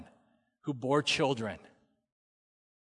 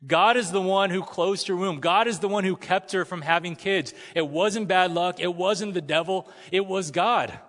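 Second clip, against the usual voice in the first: forceful preaching; 4 semitones higher, 4 dB louder than usual. Real, not acted. Preaching emphasis.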